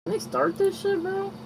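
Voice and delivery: impatient high-pitched whine; high whiny voice. Acted. Impatient whining while waiting.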